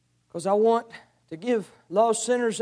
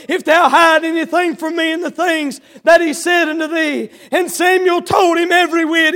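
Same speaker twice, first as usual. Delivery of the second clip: preaching; 4 semitones higher, 6 dB louder than usual. Acted. Scripture read with full force.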